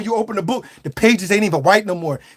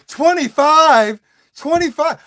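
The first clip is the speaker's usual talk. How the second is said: high, slow, louder disbelief; incredulous; 7 semitones higher, 3 dB louder, slower than usual. Retold or imitated. Customer hearing waitress is twenty-five, high shocked disbelief. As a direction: stretch it out in shock.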